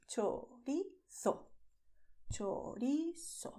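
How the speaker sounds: "Chorizo" slow, with ch as in ciao; clear ch. Shown as a demonstration. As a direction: draw out "Chorizo" syllable by syllable. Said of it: "Pay attention to the sound of ch".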